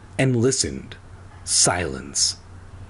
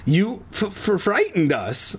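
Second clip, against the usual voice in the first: high stammer, catching on the first sound; 8 semitones higher than usual. A narrator's reading. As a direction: stutter it out in a higher, frightened voice. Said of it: "I stammered".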